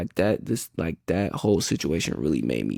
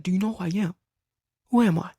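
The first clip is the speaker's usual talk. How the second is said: menacing coach; 12 semitones higher, faster than usual. Retold or imitated. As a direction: press him as an angry coach. Coach repeating his demand.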